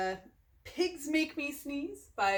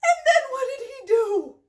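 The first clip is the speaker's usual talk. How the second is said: loud, high scandalised question; mock outrage; 6 semitones higher, 9 dB louder than usual. Reader asks children about Gerald's manners, mock outrage. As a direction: ask it as if appalled.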